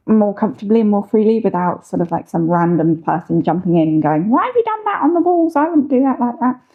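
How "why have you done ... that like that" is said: a much higher, fast, fussy troll voice; nitpicking troll voice. Retold or imitated. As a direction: play a finicky internet troll complaining. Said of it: "Do they talk like that?".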